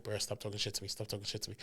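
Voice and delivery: low, very soft murmur; hushed retort. Retold or imitated. Groom to best man in his ear, hushed retort. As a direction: murmur it privately to the man beside you. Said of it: "talking shit in my ear".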